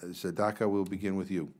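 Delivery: ordinary talk, nothing marked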